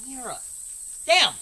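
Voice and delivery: usual speaking voice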